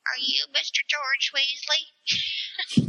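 put-on high, slow, careful child's question; little boy's voice. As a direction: attempt a young boy with a shaky accent. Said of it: "I can't do an accent".

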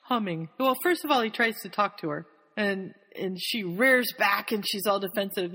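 normal talking voice

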